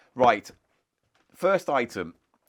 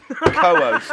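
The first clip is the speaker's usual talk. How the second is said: sudden laughing yelp; 12 semitones higher, 9 dB louder than usual. Real, not acted.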